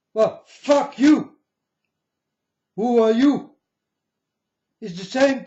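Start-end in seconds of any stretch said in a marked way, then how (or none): 0.1-3.6 much higher, louder, slow retort; angry slow comeback; defiant curse. Real, not acted. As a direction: spit it back in defiance. Defying Trump's order.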